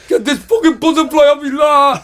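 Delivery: high, loud panicked yelling; hammed-up shriek of a grown man scared of a moth; terrified scream. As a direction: scream it over and over, bent double. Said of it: "Like that".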